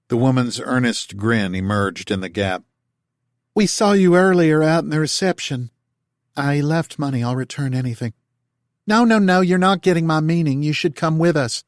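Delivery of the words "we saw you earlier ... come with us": higher, louder southern holler; down-home call. A narrator's reading. Martha calling to Tal through the door, down-home accent.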